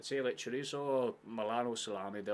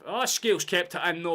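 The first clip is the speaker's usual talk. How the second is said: excited outburst; 8 semitones higher, 14 dB louder than usual. Real, not acted. Sudden save during commentary.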